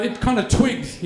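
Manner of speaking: usual speaking voice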